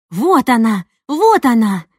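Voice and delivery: loud, thrilled cry of discovery; excited shout. A narrator's reading. Mom spots the singer on TV, loud excited shout. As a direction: call out loud in delight at the screen. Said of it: "возбуждённо закричала мама".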